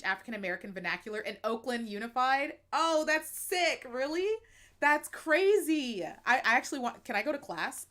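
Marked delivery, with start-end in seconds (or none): 2.7-6.0 slow, high, loud amazement; wow, that's wild; amazed. Real, not acted. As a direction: draw it out in disbelief. Amazed reaction to Oakland class.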